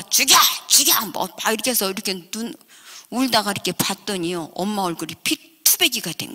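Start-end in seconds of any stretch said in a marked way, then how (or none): 0.0-1.1 short high, loud cries; defiant yelling. Retold or imitated. Stepmother to husband as they fight, yelling defiantly. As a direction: yell it out in mid-fight.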